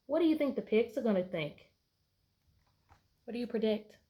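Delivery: ordinary delivery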